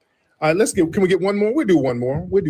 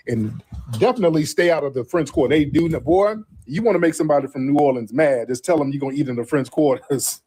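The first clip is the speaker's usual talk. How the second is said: ordinary talk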